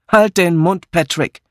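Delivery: snapping rebuke. A narrator's reading. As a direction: cut him off crossly. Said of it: "fuhr Miss Blacklock ihn an". (ihn anfahren)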